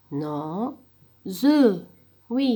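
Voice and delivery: usual speaking voice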